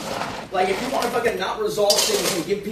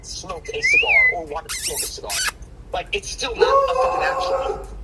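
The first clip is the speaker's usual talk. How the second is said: furious raised voice in a fight; angry shout. Real, not acted. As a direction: blow up and shout it at her. Heated argument with partner.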